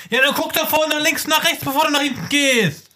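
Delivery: high-pitched mock tirade at a careless shopper; indignant scolding. Acts angry retort to shopper.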